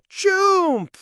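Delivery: loud high spoken sneeze "Choomp!"; sneeze sound. Acted. Cat's sneeze going off, loud explosive choomp. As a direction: blast the sneeze out.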